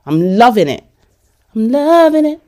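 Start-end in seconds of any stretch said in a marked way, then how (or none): 1.5-2.4 exaggerated glee. Refusing to calm down, gleefully high on "loving". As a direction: stretch "loving" in a high gushing pitch.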